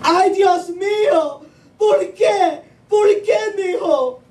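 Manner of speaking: high, crying Spanish mom lament; hammed-up wailing mother. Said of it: "she was screaming".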